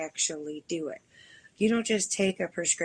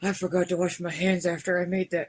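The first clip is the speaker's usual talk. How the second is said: mock panic; 12 dB louder than usual. Acting out tasting oil by mistake.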